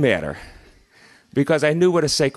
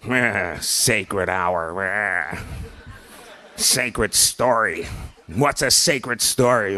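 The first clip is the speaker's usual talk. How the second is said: low, slow, grumbling scoff; grumpy Scrooge; 7 semitones lower, much slower than usual. Retold or imitated. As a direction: sneer at it like a sour old Scrooge. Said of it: "I kinda felt like Scrooge".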